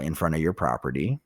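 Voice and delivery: ordinary talk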